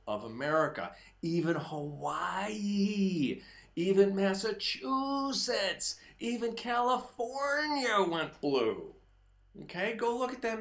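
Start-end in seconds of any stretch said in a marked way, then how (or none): 1.2-8.9 slow, stressed "Hawaii" and "Massachusetts"; building emphasis on each state; emphatic list. Acted. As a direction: lean on each state name. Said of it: "listing states, slow".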